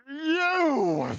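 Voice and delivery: high, loud snarling accusation; accusing. Acted. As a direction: point and spit it out. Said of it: "she says, pointing at Tomlin".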